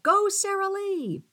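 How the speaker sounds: high, drawn-out rallying call; ringing cheer. A narrator's reading. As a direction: cheer her on from the crowd, slow and high. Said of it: "Others rang out".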